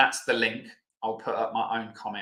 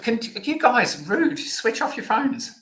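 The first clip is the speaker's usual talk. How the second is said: high-pitched, louder playful telling-off; mock scolding; 12 semitones higher, 4 dB louder, slower than usual. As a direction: jokingly chide the audience in a high, indignant voice. Mock scolding at unexpected phone noise.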